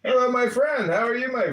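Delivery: high, bright hello; excited greeting. Real, not acted. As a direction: greet him warmly and up high. Greeting a friend on a call.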